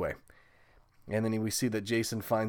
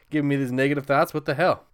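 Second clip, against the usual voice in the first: louder, higher mock indignation; half-joking exasperated shout; mock outrage; 4 semitones higher, 8 dB louder than usual. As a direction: throw up your hands at him.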